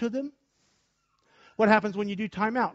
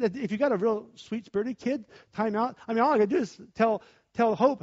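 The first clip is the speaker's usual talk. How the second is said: ordinary talk, nothing marked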